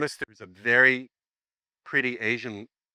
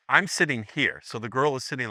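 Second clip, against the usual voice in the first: usual speaking voice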